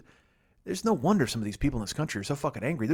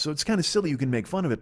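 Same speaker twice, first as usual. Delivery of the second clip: usual speaking voice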